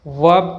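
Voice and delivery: वर्ब with no r sound; silent r. Shown as a demonstration. Teacher introducing the correct word, r silent. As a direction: drop the r in वर्ब. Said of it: "आर की sound नहीं आती".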